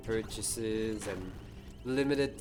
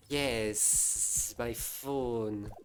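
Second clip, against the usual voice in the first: slow hissing snake voice; much slower than usual. Pretend. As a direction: hiss the words out like a snake. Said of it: "now you're just making a snake voice".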